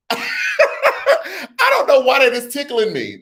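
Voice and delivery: slow words through real laughter; giggling, can't stop; laughing. Real, not acted. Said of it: "That is really tickling me".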